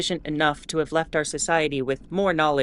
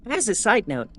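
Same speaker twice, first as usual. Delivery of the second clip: ordinary talk, nothing marked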